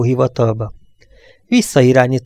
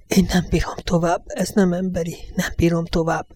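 soft, strained and higher, barely holding together; hushed, choked whisper; 6 semitones higher, 6 dB quieter than usual. Acted. The Romanian whispering to Gerard that he can't go on, hushed and strained. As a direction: breathe it out, at the end of his nerves. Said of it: "suttogta folytott hangon a román".

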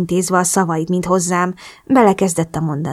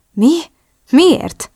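much higher, rising startled questions; taken aback; 9 semitones higher, 4 dB louder than usual. A narrator's reading. Drew learns Oliver was behind it, surprised. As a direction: blurt it out in surprise. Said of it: "lepődött meg".

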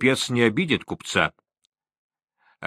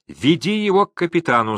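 higher-pitched, mild crowd cry; crowd shout; 7 semitones higher than usual. A narrator's reading. Crowd of Gilyaks turning on the trader, mild shout. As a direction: call it out high without much volume. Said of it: "закричали столпившиеся гелики".

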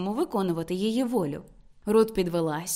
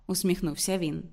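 ordinary delivery

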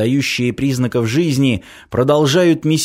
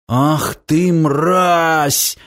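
drawn-out outraged curse, mildly raised; angry yell; 3 semitones higher, much slower than usual. A narrator's reading. Semyon to the unmasked traitor, understated angry yell. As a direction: throw the insult at a traitor. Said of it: "заорал Семён".

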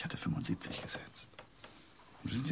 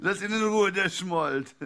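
much higher and louder, telling them off; loud rebuke; 9 semitones higher, 10 dB louder, slower than usual. Acted. Man shouting at friends to leave the sulker alone, loud. Snapping at the group.

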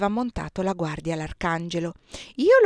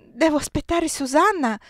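insisting; 8 semitones higher, 5 dB louder than usual. A narrator's reading.

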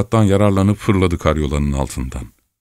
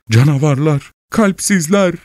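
high, distressed outcry; crying out; 8 semitones higher than usual. A narrator's reading. Wife on the sofa cursing the monsters, high cry. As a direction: wail it out in a raised pitch. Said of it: "diye bağırmaya başlamıştı".

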